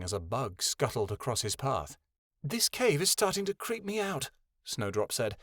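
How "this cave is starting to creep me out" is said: high, uneasy character voice; high-pitched, mildly spooked; unhappy, creeped out. A narrator's reading. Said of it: "unhappily brushing away a spider's web".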